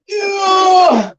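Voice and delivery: triumphant yell, high and loud; excited shout. Real, not acted. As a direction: cheer the win. Cheer after moving heavy fireplace.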